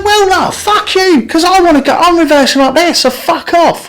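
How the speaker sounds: high, heated mock retort; hammed-up angry comeback to the driver; telling her off. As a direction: fire it back at her, pitched high. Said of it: "and I'm going".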